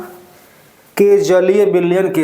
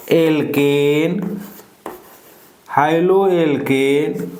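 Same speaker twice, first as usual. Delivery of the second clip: stretched words with long gaps; slow, measured read-out for note-takers; slow dictation; slower than usual. Real, not acted. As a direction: dictate piece by piece, unhurried. Dictating reaction conditions for writing.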